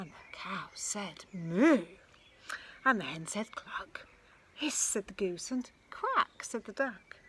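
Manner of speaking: rhythmic read-aloud chant; lively repeated refrain; storybook refrain. A narrator's reading. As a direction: bounce through the animal list. Refrain read with animal chorus.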